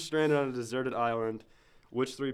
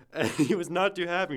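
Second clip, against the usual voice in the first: ordinary talk, nothing marked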